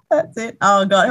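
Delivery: loud, high words through laughter; real high-pitched laughter; laughing hard. Real, not acted. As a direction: crack up while agreeing. Laughing at the joke.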